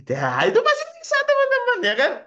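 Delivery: very high, loud exclamation; triumphant. Real, not acted. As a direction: burst out in a high-pitched cheer. Triumphant point after happy demo.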